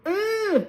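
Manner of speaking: high, strained uh; a stressed-out groan of overwhelm; hammed-up frazzled uh; flustered groan. Being flustered.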